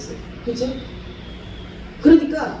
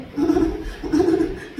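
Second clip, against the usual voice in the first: breathy giggle; 8 semitones higher than usual. Retold or imitated. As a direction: let out a breathless little laugh of delight. Cooing at a fawning dog. (반기는 개를 보며 터지는 숨 섞인 웃음)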